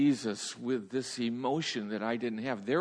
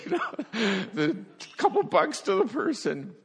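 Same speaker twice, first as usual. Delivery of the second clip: much higher and louder, breaking into laughter; laughing while telling; 12 semitones higher, 8 dB louder than usual. Real, not acted. Preacher telling congregation about weeping at toll booth, laughing loudly. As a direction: laugh your way through the toll booth story.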